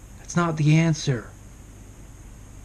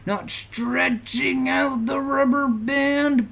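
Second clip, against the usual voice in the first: high, slow, drawn-out words; high, taut, elongated delivery; strained stretching; 8 semitones higher, 3 dB louder, much slower than usual. Shown as a demonstration. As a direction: stretch the words out like pulling elastic. Contrasts tension with leaving it limp.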